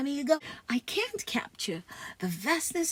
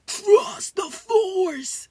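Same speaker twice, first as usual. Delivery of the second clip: loud, higher, very slow ghostly call; echoing Ulysses S. Grant; 7 semitones higher, 9 dB louder, much slower than usual. Acted. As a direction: boom it out slowly like a voice inside his head. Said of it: "heard the voice of General Ulysses S. Grant".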